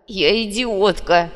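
loud, very slow anguished exclamation; drawn-out, despairing outburst; bitter self-reproach. A narrator's reading. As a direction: cry it out, condemning yourself. Girl bitterly accepts the insult.

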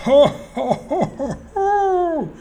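high, drawn-out hooting laugh; hammed-up wild ho-ho laughter. A narrator's reading. Trapped robber at the tricksters, wild high laughter. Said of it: "brach er in wildes Gelächter aus".

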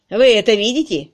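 loud, exasperated groan. A narrator's reading. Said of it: "простонал Макбрайт".

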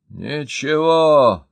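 loud, slow, dogged declaration; stubborn. A narrator's reading. Mayor stubbornly refusing help, loud slow insistence. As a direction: dig in your heels out loud. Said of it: "упрямо сказал городской начальник".

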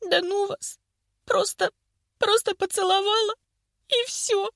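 high, tearful, broken phrases; sobbing. A narrator's reading. Vika after being teased about Egor, sobbing. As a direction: squeeze the words out between sobs. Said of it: "разрыдалась".

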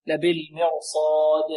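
slow recited لبالمرصاد with a distinct bounce on final د; clearer qalqalah. Shown as a demonstration. Teacher shows qalqalah at a pause, لبالمرصاد ending in a bounced د. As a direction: make the final د of لبالمرصاد rebound audibly. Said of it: "والقلقلة تكون أبين".

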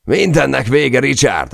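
high, loud despairing yell; giving up at the top of his voice; yelling back. Acted. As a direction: roar it back in his face. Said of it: "ordította vissza".

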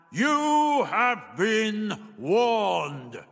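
high, extremely drawn-out boom; booming warning. A narrator's reading. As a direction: intone it hugely slowly, like an unseen power. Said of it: "the voice repeated".

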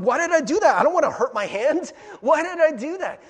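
loud, frustrated self-reproach; exasperated outburst. Retold or imitated. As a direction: yell at yourself after hitting something. Self-quote after hitting something.

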